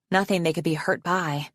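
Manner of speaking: plain reading voice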